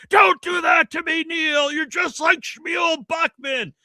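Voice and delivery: imitating a screaming fit. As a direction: scream it like he's losing it. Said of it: "He started screaming".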